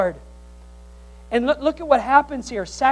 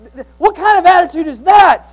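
loud, high rebuke; preacher rebuking the grumblers; indignant shout; 7 semitones higher, 12 dB louder than usual. Real, not acted. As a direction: shout the question in disbelief.

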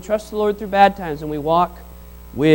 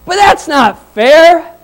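slow, higher, louder whine of protest; whiny complaint; 9 semitones higher, 9 dB louder, much slower than usual. Retold or imitated. Early laborers protesting to the master, mock slow whine. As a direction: drag it out like an aggrieved worker.

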